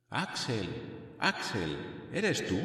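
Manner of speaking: faint voice from far off; distant voice. A narrator's reading. Uncle calling faintly through the rock, distant. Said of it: "llegaron a mi oído estas palabras".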